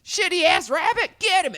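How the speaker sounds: put-on very high, loud kid yell; mock kid shouting. Podcaster imitating kids attacking Bunny Boy, high mock shout. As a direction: yell like a brat leading the chase.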